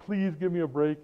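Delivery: high, pleading student begging for a makeup test; whiny student pleading. Retold or imitated. Student pleading with the teacher for a break. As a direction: beg like a kid who missed three tests. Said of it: "a student comes to him ... and say".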